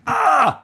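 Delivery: loud, high mock scream of frustration; sudden high, loud acted outburst; exasperated yell. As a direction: let out a frustrated parent's "Ah!".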